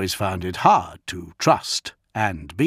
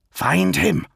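loud, high, forceful order; angry command; 9 semitones higher, 5 dB louder than usual. A narrator's reading. Lion overrules Redloss's objection, loud angry command. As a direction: fire off the order, no argument allowed.

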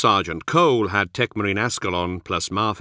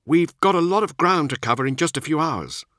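higher-pitched squad commander's voice; brief the men in a raised character voice; narrator's higher voice for an officer; Nemiel; 9 semitones higher than usual. Acted.

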